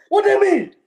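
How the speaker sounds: amused loud laugh-through; laughing question. Real, not acted. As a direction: ask it while cracking up. Laughing at friend's F T P.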